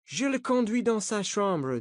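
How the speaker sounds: lightly voiced American coach; American accent. A narrator's reading. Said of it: "avec un accent américain". (accent américain léger)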